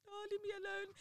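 high, soft mock whine; retold crying. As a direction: imitate a tearful, small-voiced plea. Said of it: "and I was fully crying".

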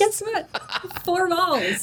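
mock bragging. Echoing the joke.

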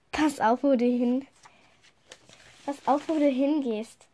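plain reading voice

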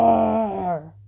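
long, full-throated lion roar; great big roar. A narrator's reading. Lion leapt out of the bushes, great big roar. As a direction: let out a huge drawn-out roar. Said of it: "gave a great big".

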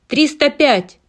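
high, loud bid; shouted bid. Acted. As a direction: shout out the number. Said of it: "вступил в торг другой участник".

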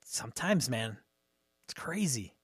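hushed whisper aside. Real, not acted. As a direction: drop to a whisper.

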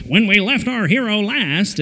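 put-on vintage radio narrator voice; old radio serial announcer. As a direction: intone it like an old serial recap. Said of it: "one of those old serials on the radio".

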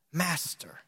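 low, soft, tired Master; weary sigh. Retold or imitated. Simon to Jesus, weary and frustrated. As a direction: sigh it out, worn down. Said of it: "You can hear the frustration in his voice".

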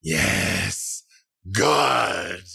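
airy voice from nowhere; disembodied voice. A narrator's reading. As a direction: speak as an unseen presence. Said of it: "you hear Nepenthe's voice from nowhere in particular say".

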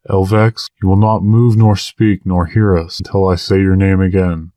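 usual speaking voice

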